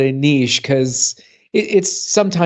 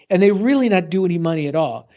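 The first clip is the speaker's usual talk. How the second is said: usual speaking voice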